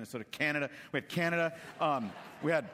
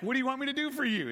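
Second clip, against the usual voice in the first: much higher, quick, playfully naive; mock innocent question; 11 semitones higher, 4 dB louder, faster than usual. Shown as a demonstration. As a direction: ask it as if you didn't notice he's blind. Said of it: "See, that's the humor part of me".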